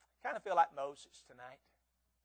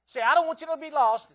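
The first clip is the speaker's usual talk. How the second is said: loud, high pleading cry; 9 semitones higher, 12 dB louder than usual. Acted. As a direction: cry out the plea to the lost. Pleading peak.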